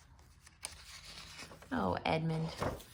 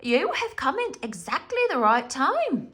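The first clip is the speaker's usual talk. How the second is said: high, loud beaver wife voice; Missus Beaver; 8 semitones higher, 5 dB louder than usual. A narrator's reading. Missus Beaver greeting the children, high and eager. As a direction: welcome them brightly. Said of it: "said Missus Beaver".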